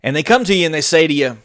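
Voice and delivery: ordinary talk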